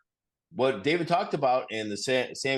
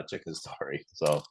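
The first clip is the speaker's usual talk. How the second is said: normal talking voice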